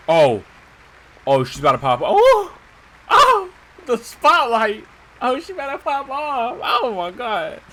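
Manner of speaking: real excited yelling, voice jumping high; hyped up. Real, not acted. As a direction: cheer the big moment as it starts. Cutscene starts, streamer hyped.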